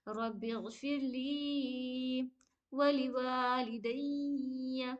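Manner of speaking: very slow chanted recitation of रब्बी गफिरली वली वालिदैया; slow Quranic recitation. Shown as a demonstration. Teacher reciting the line for students to repeat. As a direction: recite it slowly, या in वालिदैया kept short. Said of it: "या को नहीं खींचेगा".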